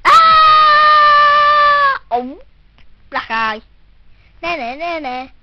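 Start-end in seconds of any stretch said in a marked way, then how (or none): 0.0-2.0 high, loud shriek of pain; piercing scream. Acted. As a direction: let out a sharp high scream. Character is hit, screams.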